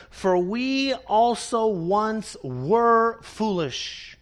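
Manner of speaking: each word drawn out, very slow; slow scripture reading. Acted. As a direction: let the verse sink in, slowly. Reading the verse slowly.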